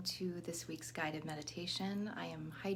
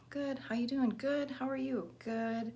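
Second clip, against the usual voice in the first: bright, breezy autopilot greeting, pitched higher; mock cheerful small talk; 7 semitones higher, 4 dB louder than usual. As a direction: chirp it like a polite mask hiding the hurt. Fake-cheerful social mask reply.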